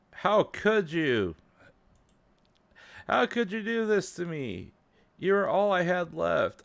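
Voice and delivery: high, hurt, pleading character voice; a betrayed girl begging the one who deleted her; high pleading game girl. Acted. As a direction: plead with him in a high, wounded girl's voice. Voices Monika pleading with player.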